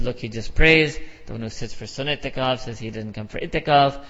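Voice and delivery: normal talking voice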